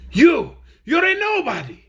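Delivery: high, loud scornful shout. Acted. Captain Fighter shouting at Chopfit, loud scorn. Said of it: "shouted Captain Fighter".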